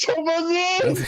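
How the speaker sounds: high, slow disbelieving question; can't believe that name; incredulous. Real, not acted. Incredulous at unknown artist name.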